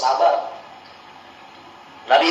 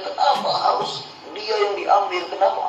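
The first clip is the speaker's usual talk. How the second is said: mock wailing protest; 6 semitones higher, 4 dB quieter than usual. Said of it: "Nggak boleh teriak-teriak".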